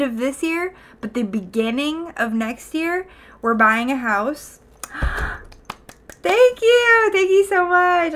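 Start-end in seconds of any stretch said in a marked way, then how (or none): 6.2-8.1 much higher, louder thank-yous; gushing thanks. Real, not acted. Streamer thanks chat for congratulating her on the house. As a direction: thank them, overflowing with delight.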